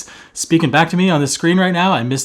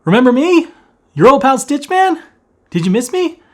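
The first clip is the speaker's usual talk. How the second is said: high, loud gleeful greeting; excited hello; 10 semitones higher, 5 dB louder than usual. Acted. Host greeting viewers after long break, gleeful and loud. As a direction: burst in thrilled to be back. Greeting return as character persona.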